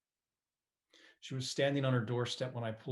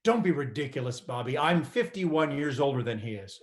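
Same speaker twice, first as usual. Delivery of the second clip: defensive; 3 semitones higher, 9 dB louder than usual. Retold or imitated. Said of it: "She got all defensive".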